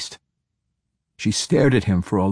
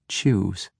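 cold command; 3 dB quieter than usual. Acted. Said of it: "Ivanel instructed".

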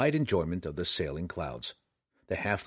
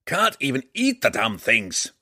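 higher, louder gruff dwarf; gruff gravelly dwarf voice; 4 semitones higher, 6 dB louder than usual. A narrator's reading. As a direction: bark it like a cranky old dwarf. Dwarf Bruenor grumbles.

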